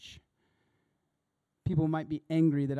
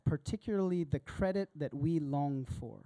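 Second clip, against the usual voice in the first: ordinary talk, nothing marked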